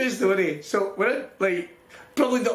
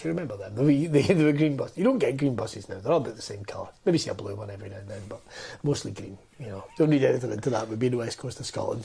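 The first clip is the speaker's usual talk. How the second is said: mock pensioner waffling about green buses; rambling old joke-teller; 7 semitones lower than usual. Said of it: "how older people will tell a joke".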